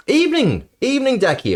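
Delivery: high, bright call of welcome; excited greeting. Real, not acted. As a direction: sing out the hello to a friend. Greeting a chat arrival loudly.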